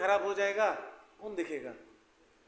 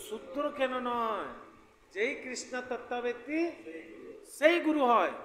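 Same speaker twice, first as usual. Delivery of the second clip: high, raised Bengali recitation; verse recitation; 6 semitones higher, 4 dB louder than usual. Acted. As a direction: quote the verse aloud in a lifted, declaiming voice. (ऊँचे स्वर में श्लोक-पाठ)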